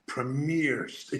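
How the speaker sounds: ordinary talk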